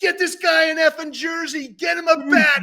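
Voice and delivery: high, loud shouted orders; retold yelling. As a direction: shout orders across the store, imitating him. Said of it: "he starts yelling".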